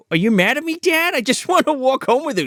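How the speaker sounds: put-on high kid voice asking dad; imitated pleading kid. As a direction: mimic a young son in a high voice. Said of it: "He's like".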